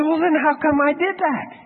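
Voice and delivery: high, mock-innocent question; acted-out plaintive bewilderment; baffled protest. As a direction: ask it back in a high, puzzled voice. Acted question back to God.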